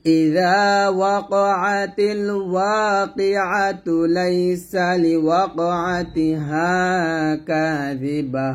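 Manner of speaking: slow, drawn-out melodic tajweed chant; slow Quranic recitation. Shown as a demonstration. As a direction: recite it slowly and reverently, stretching each vowel. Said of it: "এরকম আপনি মিলায় পড়তে পারেন".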